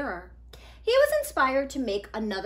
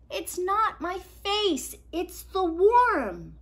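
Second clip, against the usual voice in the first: put-on higher, slow, deliberate boy; slow patient explaining; 8 semitones higher, 6 dB louder, slower than usual. Nico slowly explaining to his dad, patient and slow. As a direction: spell it out slowly.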